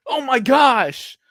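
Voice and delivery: high, loud, drawn-out gushing; hammed-up amazement, stretched out; mock commentator excitement. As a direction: imitate an overexcited announcer on a big play. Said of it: "and they're like".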